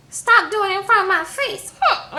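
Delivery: high-pitched huffy imitation; hammed-up petulant scolding; mock indignation. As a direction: huff it out high, like an offended sister. Darcy scolds her flirting sister.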